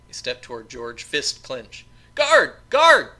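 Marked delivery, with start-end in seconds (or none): 2.1-3.1 high, loud cry for help, twice; desperate yell. Acted. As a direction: scream for the guard in panic. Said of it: "yelled George".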